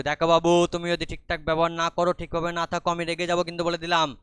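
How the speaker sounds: warning father. Acted. Father warning his daughter.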